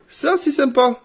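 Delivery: high, bright burst of delight. Real, not acted. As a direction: exclaim it, thrilled. Delighted reaction to quiz cards.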